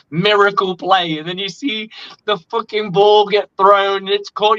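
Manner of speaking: ordinary delivery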